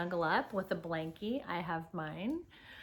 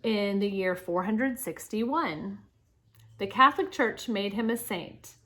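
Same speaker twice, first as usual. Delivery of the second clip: projected, lilting read-aloud with punched openings; reading to children; 2 semitones higher, 7 dB louder than usual. Acted. Teacher reads Saint Patrick's life story to children, animated read-aloud. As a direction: read clearly to the class, lifting key words.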